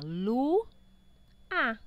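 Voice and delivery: "Lu" held, a pause, then a separate "a"; syllable by syllable. Shown as a demonstration. Teacher demonstrates syllables to pupils, Lu and a said apart. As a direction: say Lu, wait, then a. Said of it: "foi pronunciado corretamente a uma sílaba".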